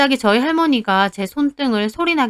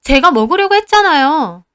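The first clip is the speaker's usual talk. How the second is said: a little higher and louder, indignant; protesting; 5 semitones higher, 5 dB louder than usual. Retold or imitated. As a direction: argue back that it was hers. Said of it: "저도 못 참고 따졌죠".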